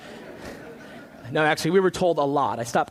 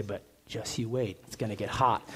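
mock ominous warning; 6 semitones lower, 7 dB quieter than usual. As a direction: lean in and warn them quietly. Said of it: "and they say".

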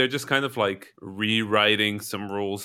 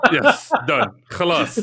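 high, loud mock triumph; triumphant fake fix; 11 semitones higher, 4 dB louder, slower than usual. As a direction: declare victory like a hack. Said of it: "they're being game developers".

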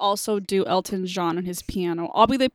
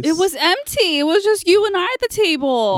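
indignant protest; 9 semitones higher, 6 dB louder than usual. Real, not acted. Protesting teasing.

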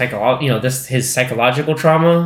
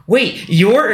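startled recognition; 8 semitones higher than usual. Retold or imitated. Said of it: "she's like".

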